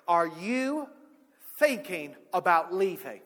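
loud, measured challenge, each part separated; confronting. Retold or imitated. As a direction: demand it like a stern mentor. Retelling mentor's angry confrontation.

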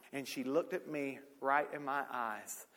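ordinary delivery

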